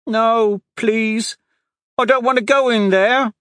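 put-on high, loud pleading child voice; a terrified little boy begging not to go in; boy crying in fear. A narrator's reading. Street boy begging the guards, high frightened plea. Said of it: "the boy cried".

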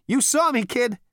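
high, loud brash Zaphod; cocky Zaphod voice. Acted. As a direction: play it cool and cocky.

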